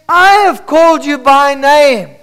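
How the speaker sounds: high, full-force preaching; ringing voice of God speaking; loud proclamation. Acted. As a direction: call it out over the congregation. Preacher proclaims God's words.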